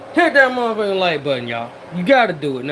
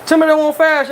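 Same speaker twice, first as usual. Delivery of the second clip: louder, higher insistent repeat; emphatic; 5 semitones higher, 5 dB louder than usual. Real, not acted. Scene: cook tells viewers how fast it cooked, emphatic. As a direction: push the point home to viewers. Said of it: "repeats the line louder".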